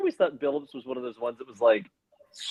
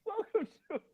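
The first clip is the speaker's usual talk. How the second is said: hammed-up higher sob, slow; mock crying; 13 semitones higher, 5 dB louder, slower than usual. Host pretending to cry over a comment, hammed-up, slow, higher. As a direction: fake wail for comic effect.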